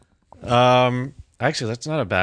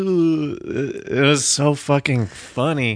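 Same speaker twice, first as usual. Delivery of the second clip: ordinary talk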